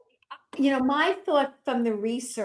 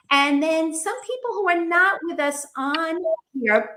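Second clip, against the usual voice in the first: ordinary talk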